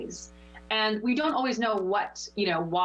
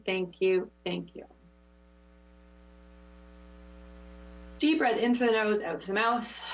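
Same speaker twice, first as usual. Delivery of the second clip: guided meditation. Acted. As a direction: ease them back gently. Leads meditation close.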